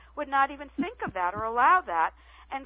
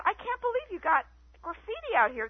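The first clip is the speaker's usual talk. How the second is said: much higher, mock incredulous; retold disbelief; 10 semitones higher than usual. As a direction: play her own wide-eyed disbelief.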